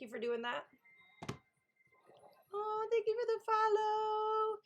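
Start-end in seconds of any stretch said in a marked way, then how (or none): 2.5-4.6 high, slow, sing-song thank you; gushing thanks. Real, not acted. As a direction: coo your gratitude. Thanking a new follower.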